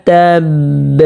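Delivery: slow, measured recitation with long held vowels; teacher's slow tajweed model; Quran recitation. Shown as a demonstration. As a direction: recite the verse steadily, drawing out each long sound. Teacher recites the verse demonstrated.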